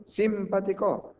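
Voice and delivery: SIM-patico, SIM stretched high; Simpático misstressed as SÍMpatico, slow and high; wrong stress on first syllable. Shown as a demonstration. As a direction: hit SIM hard in Simpático, the rest trailing. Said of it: "¿Cómo lo diríamos?".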